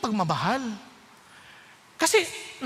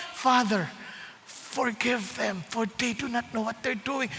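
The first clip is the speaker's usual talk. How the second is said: tender plea; 3 semitones lower, 6 dB quieter than usual. Acted. Jesus on the cross pleading softly for his killers. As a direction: breathe the words out with mercy.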